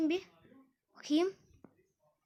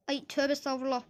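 ordinary talk, nothing marked

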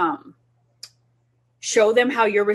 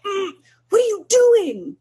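higher, louder mock-disapproving "what are you doing"; mock annoyed parent; 11 semitones higher, 4 dB louder than usual. Parent showing how not to react, mock annoyed. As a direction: ham up a frustrated parent's glare. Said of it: "your face isn't like".

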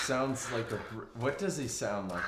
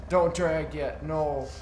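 higher, louder, insistent repeat; louder urgent repeat; 6 semitones higher, 6 dB louder, slower than usual. Real, not acted. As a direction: shout it the second time. Urgent warning in firefight.

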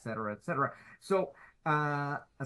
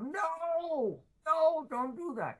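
high-pitched pleading cry of no; imitating crying out; 12 semitones higher, much slower than usual. Retelling the plea to stop, high imitated cry. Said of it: "Like crying out".

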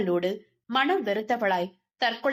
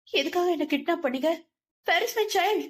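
high, firm, demanding question; 6 semitones higher than usual. A narrator's reading. Wife resolutely demands her child from mother-in-law, high and firm. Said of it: "தீர்க்கமாக கேட்டிருந்தாள்".

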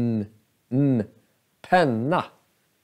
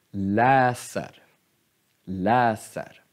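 läser with the weight on LÄ; stress first syllable; 5 semitones lower, slower than usual. Shown as a demonstration. Teacher showing stress on the first syllable. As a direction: punch LÄ in läser, let ser fall away. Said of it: "första stavelsen betonad".

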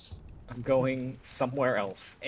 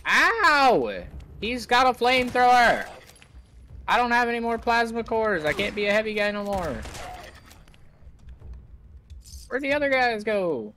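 panicked; 10 semitones higher, 6 dB louder than usual. Real, not acted. Under fire in game.

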